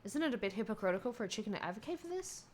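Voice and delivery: usual speaking voice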